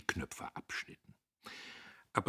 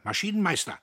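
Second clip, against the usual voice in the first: high, loud angry call; sharp shouted call; shouted summons; 13 semitones higher, 8 dB louder, faster than usual. A narrator's reading. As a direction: yell for the foreman across the workshop. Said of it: "schrie er".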